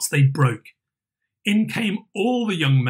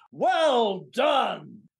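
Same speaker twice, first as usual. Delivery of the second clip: higher jovial call; a jolly boss praising the dancers; Fezziwig cries out; 5 semitones higher than usual. A narrator's reading. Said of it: "cried out".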